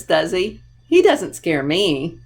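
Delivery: ordinary delivery, nothing marked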